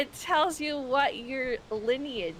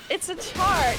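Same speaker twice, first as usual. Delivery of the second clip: exasperated shout; 6 semitones higher, 7 dB louder than usual. Real, not acted. Defending herself, raising voice.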